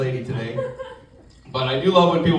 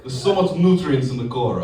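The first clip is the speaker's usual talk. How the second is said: Russian coworker; 4 semitones lower than usual. Acted. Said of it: "I work with a guy from Russia".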